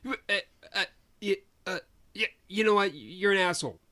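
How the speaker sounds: high, loud stammer bursting into an insult; imitated flustered outburst. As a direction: act out a man short-circuiting, then lashing out. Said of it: "I could tell I rebooted his mind".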